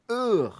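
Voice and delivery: high-pitched little "ugh"; mock grunt. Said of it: "index finger just going".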